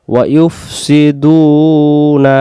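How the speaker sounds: slow chant with long held vowels. Shown as a demonstration. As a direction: draw out ওয়া ইউফ সি দুনা melodically. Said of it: "টান দেন". (টান দিয়ে সুরে তিলাওয়াত)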